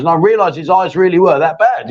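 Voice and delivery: ordinary delivery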